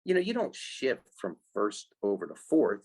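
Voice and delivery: ordinary talk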